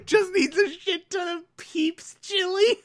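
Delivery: very high squeal through laughter; helpless laughter. Real, not acted. Host losing it over Peeps chili, helpless high laughing. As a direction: squeeze the words out high while cracking up.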